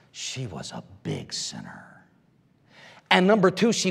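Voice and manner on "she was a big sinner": very low and much softer, almost breathed; hushed drop. Acted. Preacher telling the church she was a sinner, hushed and low. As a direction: let the word sink to a low hush. Dramatic drop on key word.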